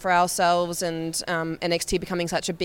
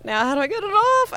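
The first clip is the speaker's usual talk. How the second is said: panicked question; 13 semitones higher than usual. Retold or imitated. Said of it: "I was like".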